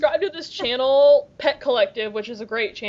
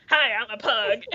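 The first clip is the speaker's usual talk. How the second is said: lightly put-on pug voice; talking pug; 3 dB quieter than usual. Repeating the pug line that cracked her up, talking pug. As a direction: imitate the pug introducing itself, just slightly in character. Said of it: "the first line out of the pug".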